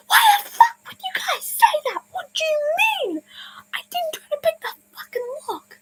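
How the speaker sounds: very high, squealed crying protest; imitated sobbing. Camper sobbing at false accusation, imitated tearful protest. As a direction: wail it in a mock-sobbing squeal. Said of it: "I just start sobbing".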